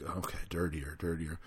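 quietly puzzled repeating her word; hesitant mumble. Retold or imitated. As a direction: mumble it under your breath, unsure. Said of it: "I'm like".

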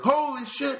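high, loud scream of shock; screamed exclamation. Retold or imitated. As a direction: scream it as a startled outburst. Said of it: "they screamed like that".

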